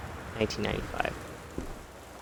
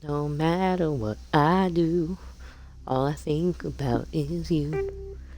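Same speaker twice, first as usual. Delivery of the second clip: southern belle; 6 semitones higher, slower than usual. Pretend. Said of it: "a dumb accent stream".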